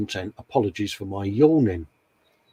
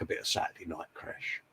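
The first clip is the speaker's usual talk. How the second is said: breathy whispered aside, almost to himself; 8 semitones lower, 20 dB quieter, faster than usual. Real, not acted. Whispering a cheeky aside to his co-host. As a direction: drop to a whisper for the joke. Jokey aside about the crash.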